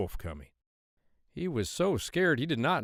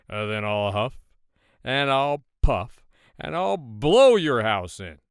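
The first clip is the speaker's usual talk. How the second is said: louder, slow big bad wolf threat; growled wolf threat; 8 dB louder, slower than usual. Wolf threatening the third pig, slow and booming.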